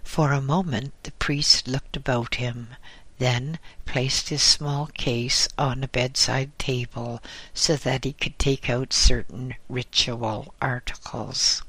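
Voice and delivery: usual speaking voice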